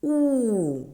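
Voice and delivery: "у" held long, starting high and gliding down; drawn-out "у" with a falling glide; isolated vowel. Shown as a demonstration. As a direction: sound out a sustained "у" for pupils. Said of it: "Шукаємо звук".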